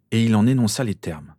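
ordinary delivery

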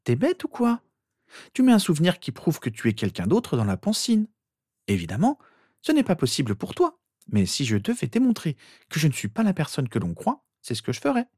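put-on high, impatient girl's voice; exasperated sister. A narrator's reading. Sister explaining the obvious to Harry, exasperated. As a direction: explain as if to an idiot, pitched high.

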